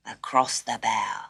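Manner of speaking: ordinary delivery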